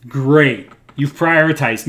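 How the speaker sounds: usual speaking voice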